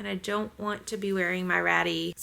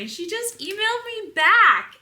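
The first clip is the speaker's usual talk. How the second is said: much higher, louder, bursting with excitement; thrilled; 11 semitones higher, 5 dB louder than usual. Real, not acted. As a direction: gush it out like great news. Thrilled retelling of the reply.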